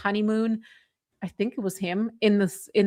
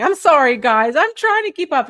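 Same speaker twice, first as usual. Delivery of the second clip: higher, louder flustered apology; flustered laughing apology; 5 semitones higher, 7 dB louder than usual. Real, not acted. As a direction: say sorry laughing and rushed. Teased by husband.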